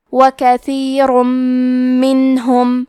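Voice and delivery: وَكَثِيرٌ مِّنْهُمْ with the tanween merged into a doubled, nasal م; وَكَثِيرٌ مِّنْهُمْ, the nun of the tanween merged into م with a long hum; slow recited وَكَثِيرٌ مِّنْهُمْ, nasal merge; idgham with ghunna. Shown as a demonstration. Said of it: "تنوین کو میم میں ملا کے غنہ اور تشدید".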